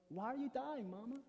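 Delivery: put-on Forrest Gump's accent. Forrest asks his sick Mama, in his accent. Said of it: "I practiced that accent".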